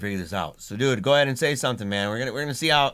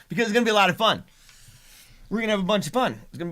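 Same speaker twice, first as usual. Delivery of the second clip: excited laughing; 9 semitones higher, 5 dB louder, much faster than usual. Real, not acted.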